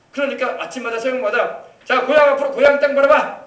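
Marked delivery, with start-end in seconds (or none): 1.8-3.4 loud, high military command; imitated army morning command; drill instructor's order. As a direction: call out orders to the new recruits. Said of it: "딱 그래요".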